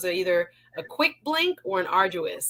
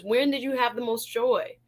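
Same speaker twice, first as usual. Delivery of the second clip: ordinary talk, nothing marked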